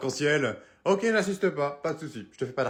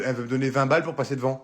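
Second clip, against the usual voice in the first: usual speaking voice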